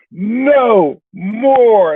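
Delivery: high, long-held exclamations; imitating a thrilled Lithuanian guy; excited Lithuanian guy. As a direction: stretch each word high with excitement. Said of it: "He goes".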